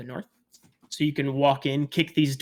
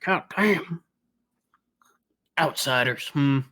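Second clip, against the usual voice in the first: lightly grumbled aside; mumbling under breath. Acted. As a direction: mumble it to yourself, mildly disgruntled. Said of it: "you just hear them mumbling under their breath".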